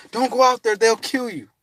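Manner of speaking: warning woman. Retold or imitated. Woman warns him.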